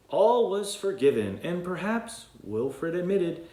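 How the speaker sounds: bright read-aloud storytelling voice; storybook narration. A narrator's reading. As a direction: read it like a bedtime book for kids. Reads story aloud to children.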